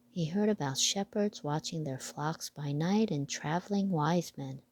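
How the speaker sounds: plain reading voice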